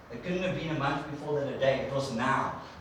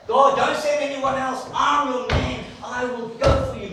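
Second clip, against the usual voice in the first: high, loud eager offer; mock eager Isaiah; eager volunteer; 9 semitones higher, 8 dB louder than usual. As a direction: beg to be the one sent. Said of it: "That was Isaiah".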